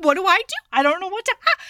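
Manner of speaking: high, loud mock helplessness; retold panic. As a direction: act out being frantic with no idea. Retold panic after pepper spray.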